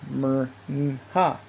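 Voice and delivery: Mer-Nha in separate pieces: mer, n, ha; standard reading. Shown as a demonstration. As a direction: sound out Mer-Nha with a lone n before ha. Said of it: "to read all attestations of the name".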